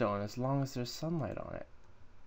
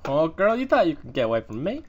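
sassy taunt; 4 semitones higher, 10 dB louder than usual. Pretend. Taunting a mob.

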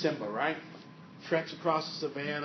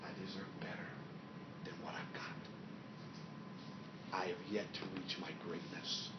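whispered, lower inner voice; 5 semitones lower, 11 dB quieter than usual. Acted. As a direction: voice the quiet longing inside. Inner voice quoted.

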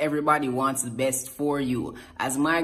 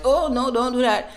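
high, louder alarmed plea to the barber; panicked plea; 10 semitones higher, 6 dB louder than usual. Retold or imitated. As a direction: cry out to stop the shears.

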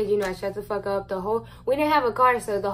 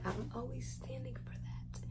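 soft unvoiced closing line; whispered; 4 semitones higher, 17 dB quieter than usual. Real, not acted. Ending her story, whispering the last words. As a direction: let it fade to a whisper. Trailing off at end of thought.

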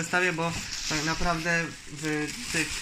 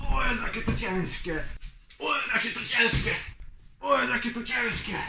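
funny voice; 6 semitones higher than usual. Shown as a demonstration. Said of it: "repeated in a put-on voice".

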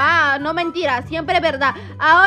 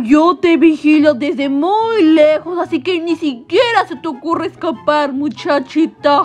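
lower, slow threat; menacing guard; 4 semitones lower, slower than usual. Acted. Guard warns the girl he watches her, slow and menacing. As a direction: draw it out like a watching villain.

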